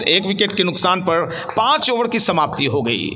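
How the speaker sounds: ordinary delivery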